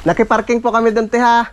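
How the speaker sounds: high call to someone inside; calling from far away. Real, not acted. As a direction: call out politely across the yard. Calling to house owner. (pagtawag mula sa malayo)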